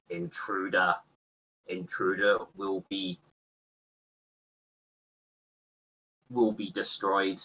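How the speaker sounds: lightly voiced guard robot, flat warning; robotic voice. Guard robot warns the intruder, lightly voiced robot. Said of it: "a robotic voice".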